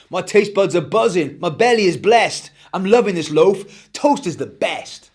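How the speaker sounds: put-on a chirpy young lad raving about breakfast; Eric's voice. Eric's voice, child hero.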